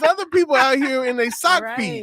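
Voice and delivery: high, loud, indignant mock complaint; mock bougie airport voice. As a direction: act offended at the airport, way up high. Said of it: "and I'm like".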